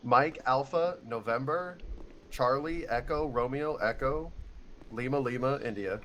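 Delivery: radio-style letter spelling; phonetic alphabet spelling. Shown as a demonstration. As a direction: spell it out with call words. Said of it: "I should do it phonetically".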